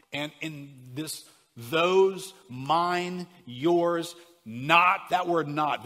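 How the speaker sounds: those, mine, yours, not called out high and loud; stress on key words. Shown as a demonstration. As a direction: punch each word as you name it. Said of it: "I wanna emphasize some words".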